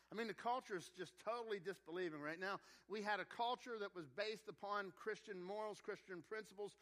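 ordinary delivery

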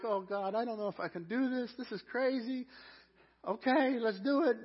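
high-pitched, hammed-up wedding jitters; nervous groom. Groom panics at the altar, mock nervous. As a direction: talk yourself into it, voice pushed up. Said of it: "I'm nervous again".

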